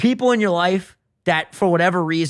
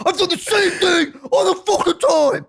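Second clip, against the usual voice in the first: high-pitched mock shouting; getting hyped up; 12 semitones higher than usual. Mike getting hype at Ronnie, mock shouted protest. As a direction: imitate someone working himself into a fury. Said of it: "And he starts getting hype".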